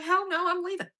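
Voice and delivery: retold defiant refusal. Telling how she refused to stay, defiant and high. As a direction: re-enact digging your heels in.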